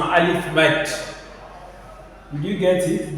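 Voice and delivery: usual speaking voice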